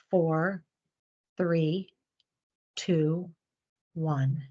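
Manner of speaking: slow counting for the breath. Shown as a demonstration. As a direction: count out each breath slowly. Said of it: "and let's go".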